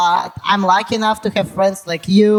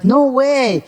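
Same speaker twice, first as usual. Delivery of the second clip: acting clueless; 6 semitones higher than usual. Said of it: "I'm acting like a full cook".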